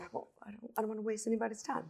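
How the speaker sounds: very soft, half-whispered to herself; muttered thought. Retold or imitated. Holding back questions, inner voice.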